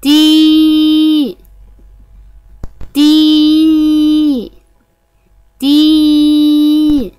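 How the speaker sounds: slow, long held vowels on a steady pitch; sustained teaching-drill syllables; drawn-out vowels. Shown as a demonstration. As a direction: stretch each syllable out for learners.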